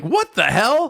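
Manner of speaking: high, incredulous "What the hell?"; mock disbelief. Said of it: "And I'm like".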